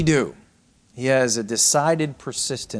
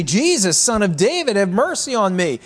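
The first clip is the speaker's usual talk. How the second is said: high, raised plea from the blind beggar; crying out; 7 semitones higher, 3 dB louder than usual. Retold or imitated. Blind beggar calling to Jesus from the roadside, crying out. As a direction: call out for mercy, pitched well up. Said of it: "he began to cry out and say".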